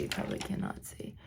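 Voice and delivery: soft unvoiced whisper; whispered aside. Real, not acted. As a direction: murmur it to the viewers.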